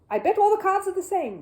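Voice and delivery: ordinary delivery, nothing marked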